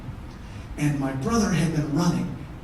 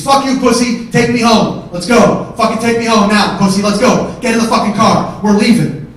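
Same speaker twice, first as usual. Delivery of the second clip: loud, insistent orders; angry demands; 4 semitones higher, 8 dB louder than usual. Retold or imitated. Brother in the car demanding to go home, loud and angry. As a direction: yell impatient commands.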